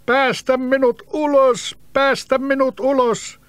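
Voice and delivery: the bottled creature cries out again; shrill little bottle creature begging; crying out. Acted. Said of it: "se huusi uudestaan".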